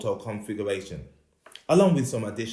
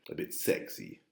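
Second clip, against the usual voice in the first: mock sexy voice; 8 dB quieter than usual. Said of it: "my voice sounds a bit deep and raspy".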